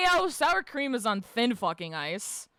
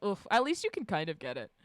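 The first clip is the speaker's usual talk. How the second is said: ordinary delivery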